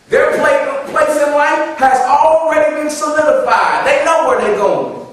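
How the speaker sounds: high, loud, frustrated parent sermon; preaching at full force. Acted. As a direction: preach it like a fed-up parent scolding kids.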